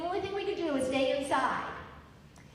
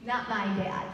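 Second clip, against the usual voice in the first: usual speaking voice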